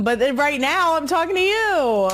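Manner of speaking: insistent, badly attempted Philly dad voice. Friend's dad putting family time first, imitated insistent Philly dad. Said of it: "like, an Italian guy".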